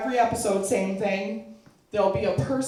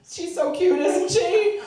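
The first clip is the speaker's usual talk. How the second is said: high sugary proud-mom voice; doting mom; 10 semitones higher, 5 dB louder, slower than usual. Retold or imitated. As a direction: gush over your daughter. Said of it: "the mom's there like".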